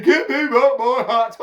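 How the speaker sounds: high, loud monkey squawk; mimicking monkeys. A narrator's reading. Monkeys pointing back at the man, mocking mimicry. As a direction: parrot the demand as a monkey. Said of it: "they're copying me".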